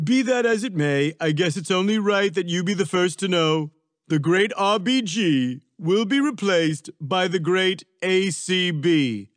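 boastful Trump. A narrator's reading. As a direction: announce the pick proudly, spacing out the name. Trump announces his pick.

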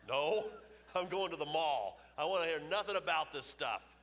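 husband scornfully refusing his wife's Bible study; scornful refusal. Retold or imitated. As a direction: brush her off with contempt. Angry husband rejects Bible study.

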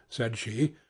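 ordinary delivery, nothing marked

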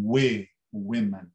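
slow, low WE, then women as WE-man; first vowel as E. Shown as a demonstration. Teacher repeating women slowly for learners, first vowel as E. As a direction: break out WE before saying women with an E. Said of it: "é assim que se pronuncia esta palavra".

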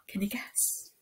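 hushed teasing whisper; secretive storytime whisper. Acted. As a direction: whisper the question to build suspense. Teasing suspense for children.

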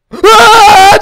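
long, high, very loud scream; terrified scream. Real, not acted. Player surprised by the girl, screaming. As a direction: scream full out in fright. Jump scare.

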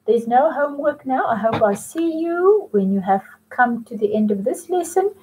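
ordinary delivery

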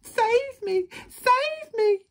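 very high, loud squeal of panic; desperate cry. A narrator's reading. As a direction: plead shrilly as a trapped little wolf. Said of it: "cried Little Wolf".

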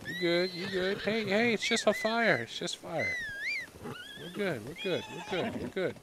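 soothing. Real, not acted. Rider soothing horse after fire scare, soft and high. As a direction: hush the scared animal.

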